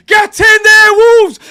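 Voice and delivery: high, very loud celebration roar; ecstatic goal shout. Real, not acted. Streamer cheering on Wolves after equaliser, ecstatic shout. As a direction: bellow in real joy at the goal.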